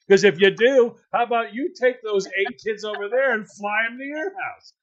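loud, high mocking sarcasm; mock the kid for being picky; hammed-up sarcastic parent; sarcastic rant. Parent mocking a kid's request.

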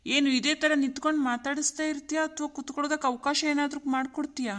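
normal talking voice

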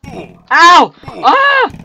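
loud, high ow and scream; pained yelps. Real, not acted. Gamer taking arrow hits, loud pained yelps. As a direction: cry out in pain twice. Hit by skeleton.

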